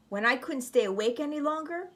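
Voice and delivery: ordinary delivery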